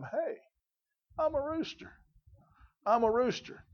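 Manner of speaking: a high, soft little rooster voice discovering itself; lightly voiced rooster, wide-eyed; young rooster. Preacher voicing a young rooster's discovery, high and soft.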